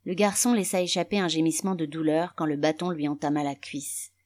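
plain reading voice

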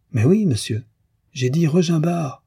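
s'écrier, plus aigu, exaspéré. A narrator's reading. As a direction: crier sa réponse avec agacement. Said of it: "s'écria Frédéric exaspéré". (Frédéric, exaspéré, corrige le cafetier en s'écriant)

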